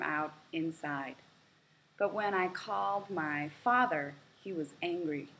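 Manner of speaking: clear, even read-aloud for learners; story reading. A narrator's reading. Teacher reading a practice story to English learners, clear read-aloud. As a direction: read the short story out for the class to follow.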